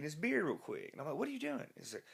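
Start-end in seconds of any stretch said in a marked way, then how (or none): 1.2-1.7 startled. Retold or imitated. Startled at friend chugging beer.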